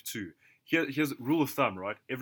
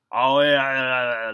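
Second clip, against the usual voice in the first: slurred, stoned; 12 dB louder, slower than usual. Retold or imitated. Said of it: "like, slurring on his words and shit".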